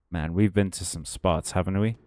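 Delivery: ordinary talk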